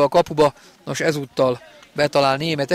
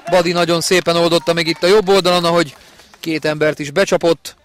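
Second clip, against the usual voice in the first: ordinary delivery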